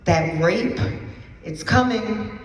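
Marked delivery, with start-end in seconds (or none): none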